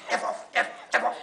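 imitating a voiceless stammer; unable to talk. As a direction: choke on the word, voice giving out. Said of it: "He couldn't talk".

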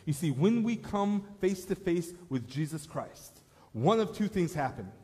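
full-force sermon climax; preaching. Real, not acted. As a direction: press the choice on the congregation. Preaching the altar-call point.

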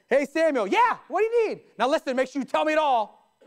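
loud, pushy call to Samuel; calling him out. Retold or imitated. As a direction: bark the name out like a demand. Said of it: "You don't just call somebody out like that".

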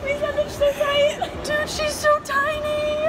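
high, giddy gushing; gushing after meeting her idol; giddy squeal. Real, not acted. Just met her idol.

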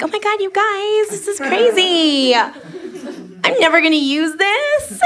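high, slow, fake-surprised gushing; mock shocked girl. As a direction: act all shocked for the girlfriends. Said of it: "be like".

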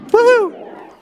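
high loud cheer; excited whoop. Real, not acted. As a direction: whoop with pure joy. Celebrates landing the combo.

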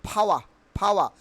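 "ফাওয়া" as phaa-wa, silent r; corrected pronunciation. Shown as a demonstration. Teacher drilling learners, short "ফাওয়া" with no r. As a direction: puff the p in "ফাওয়া", drop the r.

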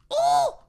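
very high, loud "oh!"; startled shriek. Real, not acted. Player startled in the game, high shriek. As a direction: shriek it in sudden fright.

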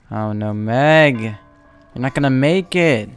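loud, drawn-out cry of dismay; alarmed yell. Real, not acted. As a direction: cry out as the chase closes in. Watching teammate about to be caught.

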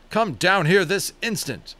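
loud, slow stern command; angry father summoning his son; angry order. A narrator's reading. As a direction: demand he come down now.

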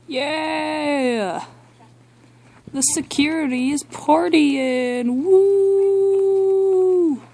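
high, drawn-out party cheers; drunk party whooping. Acted. Security guards partying without the host, drunken whooping. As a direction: whoop it up in a high, slow holler.

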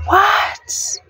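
high-pitched, stunned reaction; amazed squeal, acted out; retold shock. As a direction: re-enact being shocked, voice shooting up high. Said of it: "I was shocked. I'm like".

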